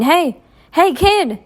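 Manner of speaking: high loud call; yelling. A narrator's reading. Marcus yells after Sal, high and loud. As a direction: shout to stop the kid. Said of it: "Marcus yelled".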